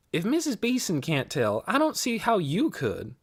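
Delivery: lightly voiced youngster, doubting; Amanda, doubtful girl. A narrator's reading. Girl doubting her friend can help, high and dismissive.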